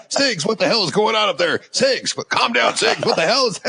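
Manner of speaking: high, exasperated mock officer; baffled officer. Bridge officer on the phone asking what's wrong, exasperated. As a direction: demand what's going on, half-laughing.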